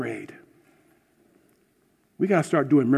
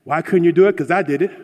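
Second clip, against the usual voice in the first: self-righteous; 4 semitones higher, 7 dB louder, faster than usual. Retold or imitated. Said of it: "Either we're self-righteous".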